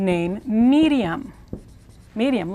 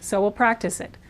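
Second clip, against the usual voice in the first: usual speaking voice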